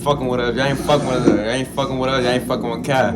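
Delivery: normal talking voice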